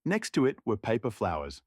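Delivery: plain reading voice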